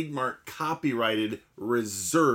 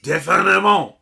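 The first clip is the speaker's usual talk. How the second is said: put-on high, loud French accent; 6 semitones higher, 7 dB louder than usual. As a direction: read it like a French chef. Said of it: "for getting your accent wrong".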